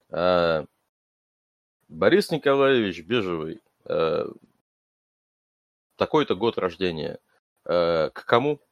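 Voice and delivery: stiff, even robot guard voice. Acted. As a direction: talk like a machine asking questions. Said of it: "голосом робота спрашивает".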